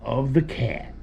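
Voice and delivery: ordinary talk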